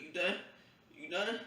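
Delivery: high, nervous repeated question; begging for it to be over; anxious asking. Retold or imitated. Anxious asking during a prick.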